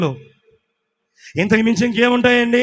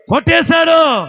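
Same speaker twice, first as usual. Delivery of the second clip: loud; 7 semitones higher, 8 dB louder, slower than usual. Acted. Shouted climax of response.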